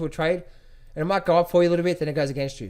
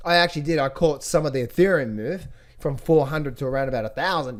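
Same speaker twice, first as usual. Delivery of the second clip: ordinary delivery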